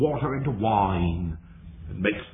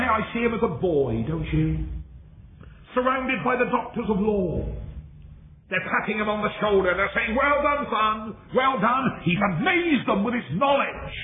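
high, ringing sermon delivery; impassioned preaching; 9 semitones higher than usual. Real, not acted. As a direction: preach it in a high, sustained declamation. Preaches at full force.

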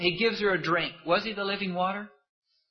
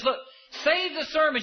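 impatient Martha; 8 semitones higher than usual. Retold or imitated. Martha brushing Jesus off.

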